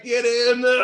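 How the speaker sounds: high, loud "get in there" roar; goal celebration shout. Retold or imitated. Fan reliving the goal, high excited yell. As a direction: yell it like the ball just hit the net.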